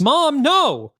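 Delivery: high loud cry of protest; shouting in protest. Acted. Daughter protests as mother storms in.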